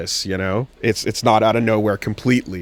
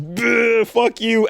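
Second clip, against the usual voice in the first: higher-pitched spat-out insult; mock taunt; 10 semitones higher, much slower than usual. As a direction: act out a cartoonish attack. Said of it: "and goes".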